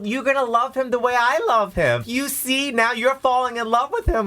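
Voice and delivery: high, gleeful proud voice; gushing. Retold or imitated. Husband picturing the public falling for Brad, high gleeful gushing. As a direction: beam it out like a proud partner. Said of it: "I was like".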